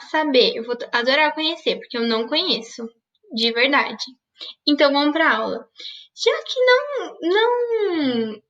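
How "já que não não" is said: high, whimpering fake sob; mock crying. Teacher mock-crying to her students that no vowelless word exists. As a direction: pretend to cry over the lost challenge.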